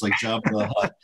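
normal talking voice

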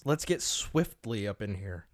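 ordinary talk

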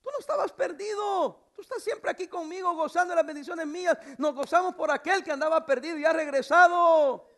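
high, building fatherly proclamation; impassioned preacher voicing the father; rejoicing father. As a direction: answer the son, swelling to a loud finish. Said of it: "Y le dijo".

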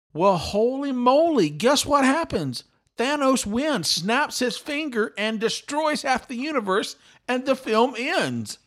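high-pitched, emphatic astonished reveal; incredulous excitement. Real, not acted. Podcaster revealing his joke about the ending came true, incredulous excitement.

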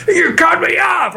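high shouted road rage. Retold or imitated. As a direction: yell it like an angry driver.

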